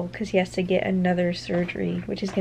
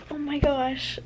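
stunned delight; 6 semitones higher, slower than usual. Real, not acted. Still stunned by the kiss.

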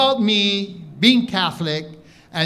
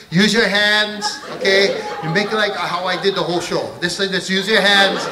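ordinary talk

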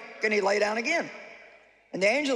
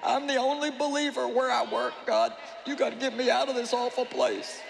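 whiny complaining to God. Retold or imitated. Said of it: "you know how it sounds".

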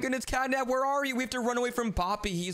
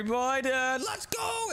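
ordinary delivery

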